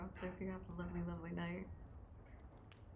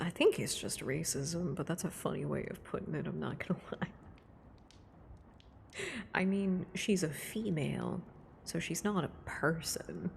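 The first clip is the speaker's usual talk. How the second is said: usual speaking voice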